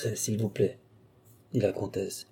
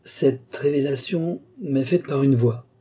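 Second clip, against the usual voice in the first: slow, as if choosing each word; slow, searching words; 3 semitones higher than usual. Acted. Balsamo slowly reveals his secret to the countess, slow and deliberate. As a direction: weigh every word before saying it. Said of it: "aussi lentement que s'il cherchait mot à mot sa réponse".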